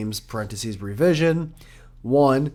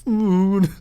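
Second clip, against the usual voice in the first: imitating friend; 6 semitones higher, 4 dB louder than usual. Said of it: "you were like".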